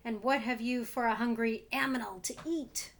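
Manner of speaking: loud fierce demand, opening on a sharp Ah; hungry aminal demanding food fiercely; fierce cry. Acted. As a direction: bark out the question like a hungry beast. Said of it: "looked around fiercely and cried".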